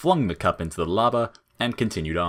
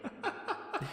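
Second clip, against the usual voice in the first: very soft, faraway breathy giggle; a tiny distant giggle; faint laugh; 13 dB quieter than usual. Acted. An echo flower replaying a friend's laugh, soft and distant.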